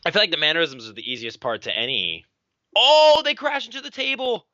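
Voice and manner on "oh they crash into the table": high, loud burst at the crash; excited outcry. Real, not acted. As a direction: call out the crash in surprise. Crash into commentary table.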